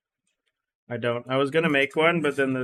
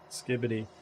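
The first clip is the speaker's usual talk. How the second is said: ironic zoomer; 6 dB quieter than usual. Pretend. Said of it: "Just gotta start talking like a Zoomer".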